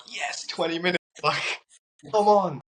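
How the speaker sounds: a fist-bump yell of victory; self-cheering. Retold or imitated. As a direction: cheer yourself on like you just won. Celebrating a twenty-minute meditation.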